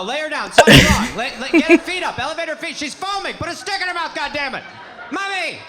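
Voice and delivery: put-on high, loud frantic child; hammed-up alarm, calling urgent orders; mock panic. Child panics over incomprehensible mother.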